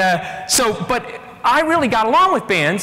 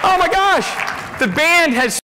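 excited; 8 semitones higher than usual. Retold or imitated. Mock cheer after the payoff.

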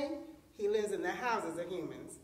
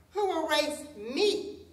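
slow, loud, higher tiger's challenge; roared challenge; 6 semitones higher, 5 dB louder, slower than usual. A narrator's reading. Swiftest tiger challenges the animals to a race, roaring. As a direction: roar it out slowly as a boastful challenge. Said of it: "roared the swiftest tiger".

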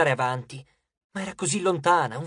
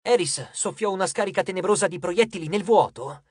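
ordinary delivery

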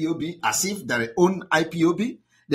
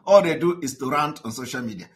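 ordinary talk, nothing marked

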